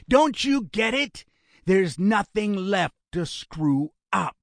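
high, forceful outburst; exasperated interruption. A narrator's reading. Gunman telling his brother it's hopeless, exasperated. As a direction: cut in hard, then stretch it out.

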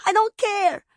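put-on high, loud child's wail; panicked wail. A narrator's reading. Child refused by her mother, wailing in panic. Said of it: "the child wailed in her panic".

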